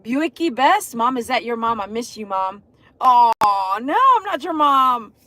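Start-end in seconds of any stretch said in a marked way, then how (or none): none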